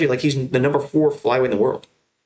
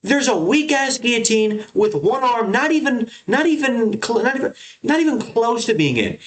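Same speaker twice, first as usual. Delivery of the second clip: higher, exasperated tirade; real frustration, pitched up and slowed; frustrated rant; 9 semitones higher than usual. Real, not acted. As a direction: vent about the commentators, fed up. Ranting about bad commentary.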